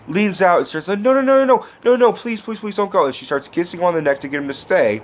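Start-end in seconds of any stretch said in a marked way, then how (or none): 0.9-3.1 high, rushed run of begging; imitated panicked pleading. Girl begging him not to go, imitated panic. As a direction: plead as if afraid of rejection. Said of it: "she is afraid of being rejected".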